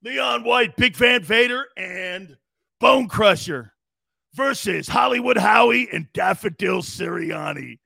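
loud wrestling announcer billing a match; mock fight-night announcement, punching the names; ring announcer. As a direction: boom out each name for the crowd.

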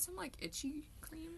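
whispering. Real, not acted. Offering cream to friend.

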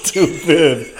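slow words through laughter; laughing. Real, not acted. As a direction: laugh while you say it. Laughing after spitting drink.

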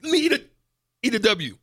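high, loud trash-talk bravado; hype shout. Pretend. Player hypes himself before a trivia shootout, loud mock bravado. Contestant psyching up before the quiz.

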